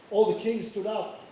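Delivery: ordinary talk, nothing marked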